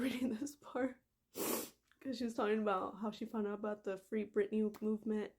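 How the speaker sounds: ordinary talk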